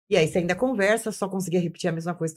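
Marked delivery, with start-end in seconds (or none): none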